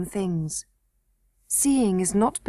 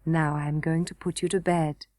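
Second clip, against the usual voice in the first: lower, softer; tender; 5 semitones lower, 4 dB quieter than usual. A narrator's reading. Grandmother to the princess, soft and low. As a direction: tuck her in gently.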